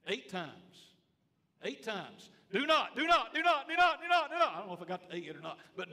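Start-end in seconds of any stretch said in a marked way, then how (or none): 2.5-4.5 much higher and louder, each "do not" hammered; thundering repetition. Acted. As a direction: pound the command like a preacher at full force. Preacher hammers the repeated command.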